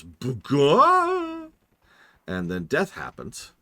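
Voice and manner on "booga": squeaky high shout of nonsense; silly game noise. Retold or imitated. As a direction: yelp it like a goofy game sound. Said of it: "and it goes".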